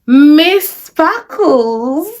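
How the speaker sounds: gushing excited praise; delighted drawn-out name. Real, not acted. Drawn-out fan exclamation.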